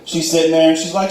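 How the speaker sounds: usual speaking voice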